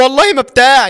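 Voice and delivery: high-pitched acted protest; desperate denial. Girl swearing innocence to her guardian, desperate denial.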